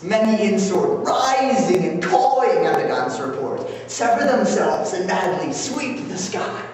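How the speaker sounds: loud, high theatrical relish, drawing out key words; mischievous sprite bragging of a prank; Puck. Acted. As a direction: build the chase, then savour the punchline. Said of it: "And now, Puck".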